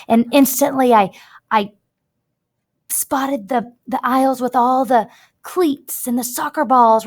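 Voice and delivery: lightly voiced kid telling a story; run-on list read out. Shown as a demonstration. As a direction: rattle it all off in one breath. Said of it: "and you're like".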